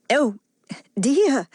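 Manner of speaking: a touch of misery, cried out; miserable cry. Acted. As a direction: plead in distress. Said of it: "cried Melanie miserably".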